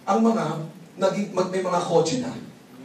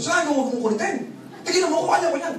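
high, mock furious yell at the recruiter; retold angry yell; 10 semitones higher, faster than usual. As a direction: bark at him like a fed-up boss. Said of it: "Sinigawan ko siya".

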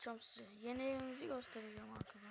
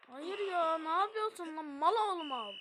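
loud, high frustrated yelling; angry outburst; 5 semitones higher, 11 dB louder than usual. Real, not acted. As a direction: yell at the game, furious. Angry gamer after conceding.

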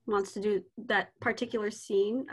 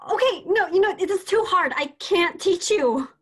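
loud and pitched up, throwing in the towel; exasperated giving up; 7 semitones higher, 6 dB louder than usual. Acted. Teacher loudly giving up on the hopeless student. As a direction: give up on him, loud and fed up.

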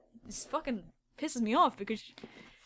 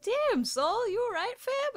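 high, squeaky mock-worried voice; mock concern; 10 semitones higher than usual. Artist asking his gloomy drawing if he's okay, high mock-worried voice.

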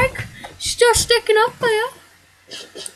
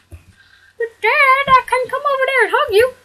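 put-on high childish son voice pleading; whiny son; 7 semitones higher than usual. Son begs his dad for love, high and whiny. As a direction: play the hurt kid begging Dad.

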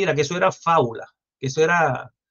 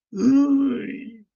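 mock disgust; 7 semitones higher than usual. Mock reaction to ugly politician.

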